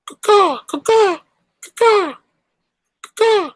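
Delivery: high, loud bird screech; eagle call. Pretend. Host greeting Pandora with a shrill eagle cry. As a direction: screech like a bald eagle swooping.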